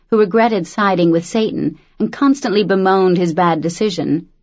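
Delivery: ordinary delivery, nothing marked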